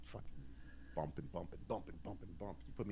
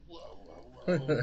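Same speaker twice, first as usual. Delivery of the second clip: a hushed whoa that bursts into a loud high cry; mock alarm, hammed up as he falls back; startled whoa; 8 semitones higher, 9 dB louder, much slower than usual. Wrestler falling back from her pose, hammed-up startled cry.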